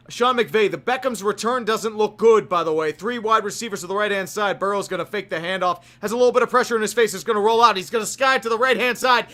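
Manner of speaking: casual mock play-by-play call. Streamer calling the Super Bowl for chat, mock play-by-play. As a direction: narrate the snap like a TV announcer, relaxed. Streamer calls the game live.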